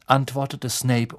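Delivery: ordinary delivery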